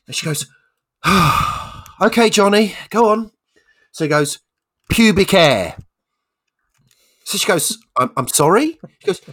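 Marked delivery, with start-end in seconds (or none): 1.0-1.9 loud theatrical sigh; exasperated sigh. Acted. Teacher dreading Johnny's answer. 2.0-3.3 high, loud resigned teacher; exasperated teacher. Acted. Teacher reluctantly calls on Johnny. 4.9-5.8 high, loud, proud answer; blunt schoolboy. Acted. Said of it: "little Johnny holds his hand up". 8.0-8.8 high, loud stammered query; teacher in mock disbelief; taken aback. Teacher taken aback by rude answer.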